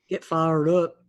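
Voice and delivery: "fired" drawled toward "fard"; lightly Southern on "fired"; Southern accent. Shown as a demonstration. Player joining the accent practice, Southern drawl. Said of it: "that kind of far thing".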